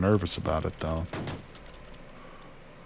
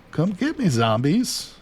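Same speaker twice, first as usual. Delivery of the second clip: high, playful call to lure them; taunting call; 8 semitones higher, 4 dB louder, slower than usual. Pretend. Gamer luring zombies over, high taunting call. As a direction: call out high, baiting the monsters.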